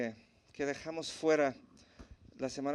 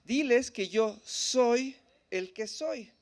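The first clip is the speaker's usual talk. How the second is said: slow, loud, higher-pitched divine declaration; God speaking; 6 semitones higher, 5 dB louder, much slower than usual. Acted. God answering Moses at the burning bush, slow solemn declaration. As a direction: declare it slowly and emphatically as God. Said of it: "Y entonces Dios le dice".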